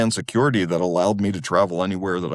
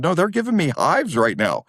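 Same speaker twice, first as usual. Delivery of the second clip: high-pitched exasperated retort; raised pitch, comic objection; retold protest; 8 semitones higher than usual. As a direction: protest it in mock alarm.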